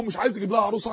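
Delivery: ordinary talk, nothing marked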